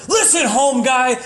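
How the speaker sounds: furious warning from Homer; angry Homer. Retold or imitated. Homer warning the comic, furious shout. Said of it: "and Homer said".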